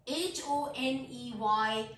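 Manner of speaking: slow letter-by-letter spelling. Shown as a demonstration. Teacher spelling a word for learners, slow spelling. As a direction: spell it out, a small gap after each letter. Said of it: "letter spell करती हूँ".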